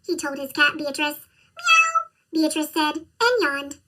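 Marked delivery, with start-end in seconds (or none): 1.6-1.9 high, lazy cat meow. Acted.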